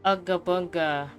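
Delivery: usual speaking voice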